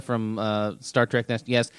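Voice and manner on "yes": lightly voiced android, louder and even; robotic Data voice. Player answering hecklers as Data would, mock robotic. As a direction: recite it like an emotionless android. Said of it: "act like he was like, uh, Data".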